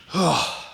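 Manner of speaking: a long, high sigh of giving in; a resigned sigh before agreeing. Retold or imitated. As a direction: breathe out the surrender. Giving in after being challenged.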